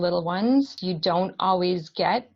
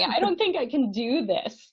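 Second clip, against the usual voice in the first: mock despair; 6 semitones higher, 5 dB louder than usual. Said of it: "in the fetal position crying".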